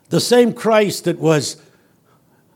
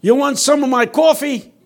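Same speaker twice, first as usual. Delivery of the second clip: high, louder friendly call across the street; homeless man calling out; 10 semitones higher, 5 dB louder than usual. Retold or imitated. A homeless man offers the preacher his coffee, calling high and loud. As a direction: call out to a passerby, high and eager. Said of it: "he spots me and he says".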